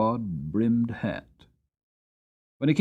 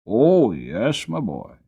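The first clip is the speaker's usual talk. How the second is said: lightly voiced grandfather, a touch louder; a hint of a fond grandfather; old farmer; 6 dB louder, slower than usual. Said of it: "said the farmer".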